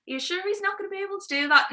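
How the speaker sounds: a lightly voiced worried girl; anxious princess.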